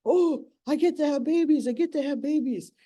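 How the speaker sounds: high, giddy toad voice; eager male toad. Acted. Clinging male toad gloats to the annoyed female, high and giddy. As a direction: gloat like an overjoyed little suitor. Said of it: "But he's holding on to her".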